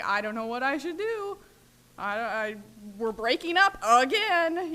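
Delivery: rising to a loud, slowed wail; retelling a frantic phone call, climbing to a loud slowed wail; upset pleading. Retold or imitated. Frantic calls to sponsor.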